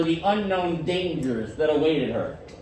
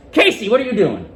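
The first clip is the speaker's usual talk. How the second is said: louder, firm scolding; firm teacher reprimand; stern tone; 4 semitones higher, 6 dB louder than usual. Shown as a demonstration. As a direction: call the student out sharply. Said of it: "What has changed? The tone".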